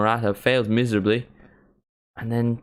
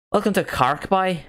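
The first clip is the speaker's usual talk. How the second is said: high, lilting Cork accent; playful Cork-accent welcome; 8 semitones higher than usual. Shown as a demonstration. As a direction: put on a sing-song Cork accent, pitched up. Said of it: "I can do a Cork one".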